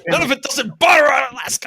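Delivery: loud, high, fast outburst; mock outraged shout. Contestant objecting to the Alaska answer, mock outraged shouting. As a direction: yell it in hammed-up indignation.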